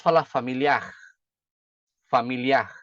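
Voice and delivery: FAMILIAR ending in a raspy back-of-throat R; back-of-throat Portuguese R. Shown as a demonstration. Teacher showing Brazilian learners the majority way, familiar with guttural final R. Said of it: "produz aquele som".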